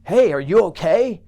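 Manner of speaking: passerby calling into a sinkhole, loud and concerned. Acted. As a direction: shout down to the man in the hole. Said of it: "he goes".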